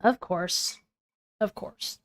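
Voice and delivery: low, soft, dry sarcasm; understated sarcasm. Shown as a demonstration. Partner showing another reading, dry sarcasm. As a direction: drop it quietly, deadpan. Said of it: "I would have read that differently".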